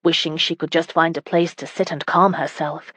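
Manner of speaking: plain reading voice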